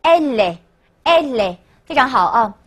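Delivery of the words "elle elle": "elle" joined slowly to "est", as "è-lè"; linked pronunciation. Shown as a demonstration. Teacher answering how she is reads linked, slow "elle" as "è-lè". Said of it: "连起来应该怎么读呢".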